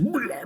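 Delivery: high, drawn-out fake gag; exaggerated heaving "bleh"; mock retching. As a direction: imitate someone about to throw up. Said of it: "wanting to throw up".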